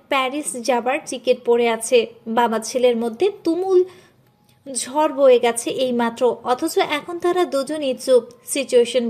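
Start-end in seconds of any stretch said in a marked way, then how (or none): none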